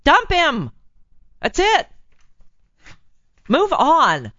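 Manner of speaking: high, punchy exclamations; emphatic command. Real, not acted. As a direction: call out each order sharply, pitch jumping up. Emphatic final advice to listener.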